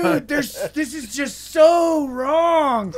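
high, loud and slow, drawn-out dismay; distressed friend. Retold or imitated. Tripping friend complaining to the group, high and drawn out. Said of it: "and he's like".

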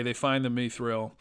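usual speaking voice

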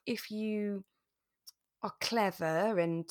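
usual speaking voice

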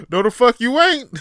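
loud, high, laughing call-out; mock shout. Calling out a fake local, loud mock shout. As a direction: shout down the imposter. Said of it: "It's like".

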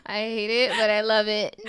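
laughing, almost crying; amused after the punchline. Real, not acted. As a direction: giggle helplessly.

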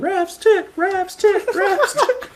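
high, singsong playground taunt; taunting kids. Retold or imitated. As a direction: chant it like teasing schoolkids. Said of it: "It would be a bunch of kids yelling".

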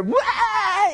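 loud high mock crying wail; toddler's pained wail. Imitating Amy in pain, a loud mock crying wail. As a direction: howl like a toddler denied a crayon. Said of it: "in pain".

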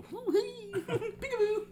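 high, squealing giggle through the words; giggly peekaboo. Retold or imitated. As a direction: squeak it out while laughing at yourself. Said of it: "lean over and go".

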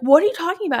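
high, loud acted question; retold bewildered outburst; exasperated alarm. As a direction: demand an explanation, rattled. Retelling her alarmed reply to him.

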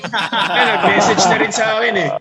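fast, high, laughing outburst; laughing excitedly. Real, not acted. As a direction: blurt it out through laughter. Laughing at caught friend.

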